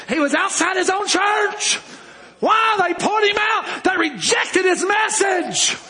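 high, impassioned pulpit shout; high-pitched preacher's outburst with stretched endings; full-force preaching. Real, not acted. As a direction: cry it out to the congregation.

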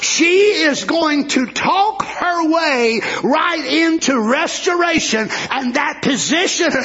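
normal talking voice

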